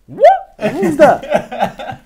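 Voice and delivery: very high, loud yelp of surprise; startled disbelief. Retold or imitated. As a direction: re-enact being utterly taken aback, shouting high. Acts his startled reaction on training ground.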